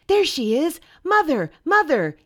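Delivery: high, loud call across the water. Acted. Baby bird calling to the passing boat, high and loud. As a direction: shout to a boat far away.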